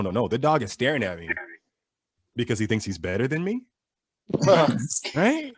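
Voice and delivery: usual speaking voice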